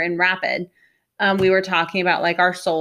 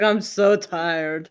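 slow tired sigh; much slower than usual. Retold or imitated. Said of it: "Lucy's like".

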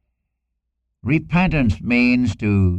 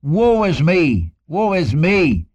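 anguished cry; 8 semitones higher, slower than usual. Retold or imitated. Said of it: "He cried".

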